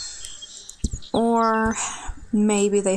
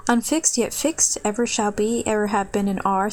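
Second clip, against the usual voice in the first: ordinary delivery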